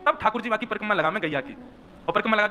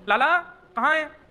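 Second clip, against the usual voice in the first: high, drawn-out searching call; mother calling out; 6 semitones higher, 3 dB louder, slower than usual. Acted. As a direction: call slowly for a lost little one. Mother calls out for her child. (ऊँचे, खिंचे सुर में बच्चे को पुकारना)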